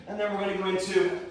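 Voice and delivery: normal talking voice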